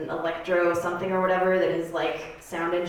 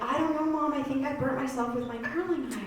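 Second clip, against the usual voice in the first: innocent daughter; 5 semitones higher, 6 dB quieter than usual. Acted. Daughter lying to mom in story.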